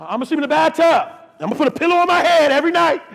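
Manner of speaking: high, loud plea building to a full shout; desperate comic shouting. Retold or imitated. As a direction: cry out to God in mock desperation. Acted desperate vow and plea.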